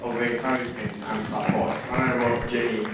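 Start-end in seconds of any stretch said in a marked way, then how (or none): none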